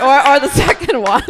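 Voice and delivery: loud, high laughing through words. Real, not acted. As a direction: crack up mid-sentence. Laughing at edible joke.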